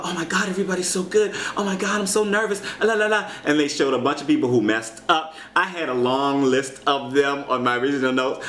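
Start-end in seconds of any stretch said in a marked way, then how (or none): 0.0-3.3 mock nervous contestant. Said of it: "running all these clips about".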